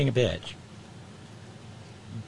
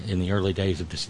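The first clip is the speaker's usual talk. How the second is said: ordinary delivery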